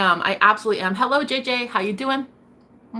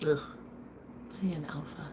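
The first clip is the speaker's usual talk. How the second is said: whispered; 3 semitones lower, 16 dB quieter than usual. Real, not acted. Confiding her romantic wish.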